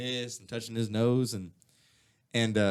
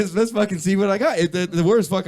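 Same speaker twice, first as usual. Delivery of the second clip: louder, higher reckless dare; retold gambler's rush, louder and higher; hyped-up bravado; 8 semitones higher, 8 dB louder than usual. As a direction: egg yourself on like a gambler going all in. Continuing hyped Chappelle quote.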